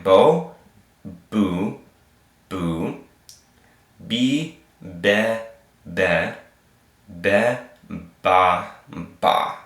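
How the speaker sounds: "Bo, boo, be, ba" held, with long gaps; vowel drill. Shown as a demonstration. Teacher leading learners to try again, drilled syllable by syllable. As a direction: punch "Bo", then space out each syllable. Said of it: "let's try it again".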